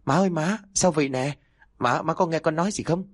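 high, panicked cry to mother. A narrator's reading. Son finding his mother on the floor, high panic. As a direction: shake her awake in panic. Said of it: "Cậu phát hoảng".

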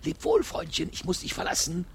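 high, sing-song taunting farewell; mock-tender goodbye to the dead man. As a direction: sneer a sweet goodbye to the corpse. Killer mocks dead victim.

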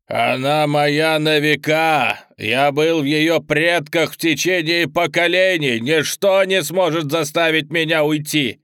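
slow, loud demonic roar; demon shouting. Acted. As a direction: bellow it like a possessed demon. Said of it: "демон посмотрел на меня и тоже закричал". (крик демона)

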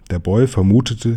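usual speaking voice

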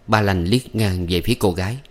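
ordinary delivery

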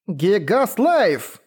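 drawn-out, high battle cry; shouted spell name. Acted. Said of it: "выкрикнула я".

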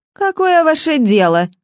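повышая голос на старуху; raising voice. A narrator's reading. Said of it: "повышая голос". (с повышением голоса)

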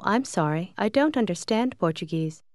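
ordinary delivery

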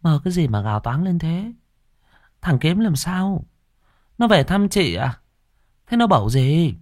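high-pitched puzzled questioning; questioning sister. A narrator's reading.

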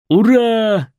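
high loud triumphant yell; yelling hooray. A narrator's reading. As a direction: whoop it out. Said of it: "завопил байкер".

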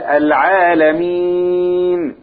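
"العالمين" with the ي held long, four counts; slow stretch on "العالمين"; four-beat madd. Shown as a demonstration. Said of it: "مد كم حركة".

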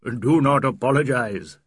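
a host mock-scolding a friend; host's dry tease. Host teases his late guest.

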